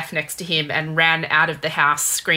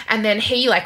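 ordinary delivery

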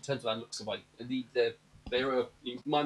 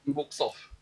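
ordinary delivery

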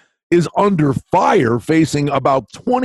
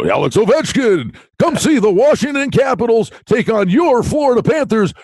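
higher hyped commercial announcer; 6 semitones higher than usual. Retold or imitated. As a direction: sell it like a TV ad. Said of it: "the guy with the announcer voice".

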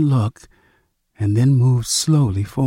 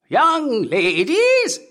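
put-on much higher, slow drawn-out old man cry; 12 semitones higher, much slower than usual. A narrator's reading. Old man hunting the girls, high drawn cry. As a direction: stretch the call high and long. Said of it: "he cried".

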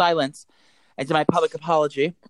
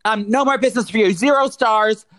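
high, emphatic declaration; retold irate; 7 semitones higher, 4 dB louder than usual. Irate customer leaving the pharmacy, retold indignant send-off. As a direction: re-enact an indignant parting shot. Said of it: "And I was irate".

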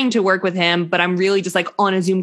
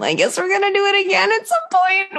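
much higher, incredulous voice; bewildered couple; 14 semitones higher than usual. Acted. Mom acting out the second-baby conversation, high-pitched bewilderment. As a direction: squeak it out in mock disbelief. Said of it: "have that conversation of".

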